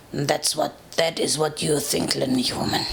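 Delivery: deep, menacing dragon growl. A narrator's reading. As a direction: growl it low like a huge wounded dragon. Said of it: "he growled".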